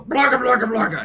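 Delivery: loud, high monster babble; monster gibberish. Acted. The elder-god monsters booming gibberish up at Zeus. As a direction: roar nonsense like a big dumb beast.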